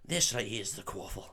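put-on soft first try at a Scottish accent; lightly put-on Scots, softly spoken. Podcaster trying out Oliver Wood for friend, Scottish accent. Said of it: "I've got the wrong voice here".

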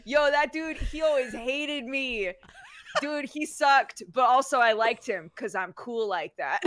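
hammed-up dude-bro voice, laughter creeping in; goddamn frat boy. Frat-boy lord grumbling about Frankenstein, hammed-up bro voice. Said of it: "like a goddamn frat boy".

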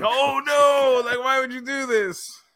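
mock dismay. Managers watching their boy turn on them, hammed-up dismay. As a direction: act betrayed, playing it up.

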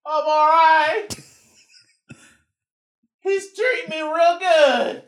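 very high, slow, loud hostage squeal; mock hostage. Hostage reassuring the caller, high put-on voice. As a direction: wail it out as a fake hostage. Playing hostage on ransom call.

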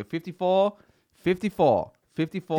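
high, loud store call, over the crowd; calling out the number. Retold or imitated. Store staff calling a ticket number, loud high call. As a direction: call the number across a packed shop.

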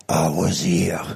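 put-on low breathy French-accented whisper; weird French accent. French accent, quoting his friend Etienne's last words. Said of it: "I said it in a weird French accent".

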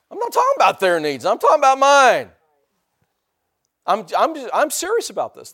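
loud retold outburst, leaping high; worked-up, self-centred objection at full voice; indignant protest. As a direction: protest loudly, as if wronged. Acting out his protest to God.

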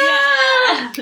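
high, loud, drawn-out yeah; celebratory cheer. Real, not acted. Cheers at correct trivia answer.

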